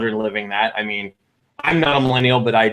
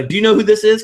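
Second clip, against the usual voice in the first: excited question; 8 semitones higher, 5 dB louder, faster than usual. Retold or imitated. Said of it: "I go".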